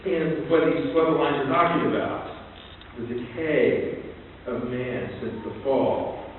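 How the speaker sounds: usual speaking voice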